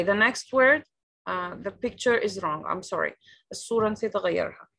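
normal talking voice

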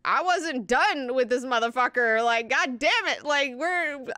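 loud, high, mock-frustrated outburst; exasperated retelling of a sore loser; bummed demon. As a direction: rant like a demon robbed of its prize. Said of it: "bummed. Like, the demon's like".

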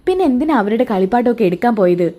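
usual speaking voice

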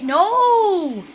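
long, high, loud wail; dismayed shout. Real, not acted. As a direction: cry out as the shot misses. Missed shot.

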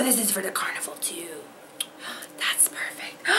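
fast, hushed whisper; delighted whisper. Real, not acted. Finding carnival supplies, she whispers with delight. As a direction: whisper it to yourself, thrilled.

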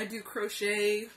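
ordinary talk, nothing marked

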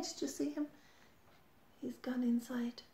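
put-on hushed secret whisper to the child. A narrator's reading. Reader whispers to child that crab hid. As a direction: breathe it like a secret.

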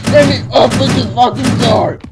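loud, high yell of frustration; frustrated shout. Real, not acted. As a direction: yell at a door that won't open. Door in the game is stuck.

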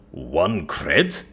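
mildly baffled question; deeply confused. A narrator's reading. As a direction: query it in puzzled disbelief. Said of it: "Deeply confused, I answer".

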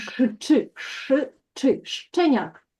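"щеняк" built up slowly from ш and чи; broken into sounds. Shown as a demonstration. Teacher helping a learner read the word, sounded out piece by piece. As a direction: sound out ш, чи, then a drawn-out "щеняк".

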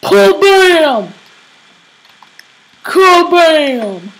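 loud, drawn-out KABAM; comic impact cry. Shown as a demonstration. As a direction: boom it out like a cartoon blast. I'm gonna just go like.

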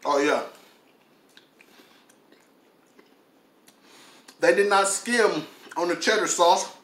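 talking while chewing macaroni; eating between words; tags: mouth full, chewing. Real, not acted. Seen 1: Forkfuls of macaroni go in mouth; jaw chewing through the words.

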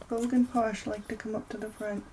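usual speaking voice

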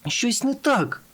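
high-pitched exclamation of alarm; alarmed cry. A narrator's reading. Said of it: "скрикнула Бріош".

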